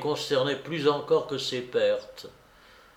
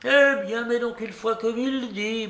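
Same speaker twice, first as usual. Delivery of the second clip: put-on high, imperious old-lady voice; commanding babouchka; 9 semitones higher than usual. A narrator's reading. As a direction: give the order in a high character voice. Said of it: "m'ordonna-t-elle". (ordre de vieille dame impérieuse)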